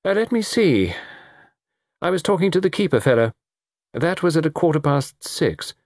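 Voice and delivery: high-pitched, halting reckoning; unsure, stop-and-start recollection; hesitant. A narrator's reading. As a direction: feel your way toward a time. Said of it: "Delengua hesitated".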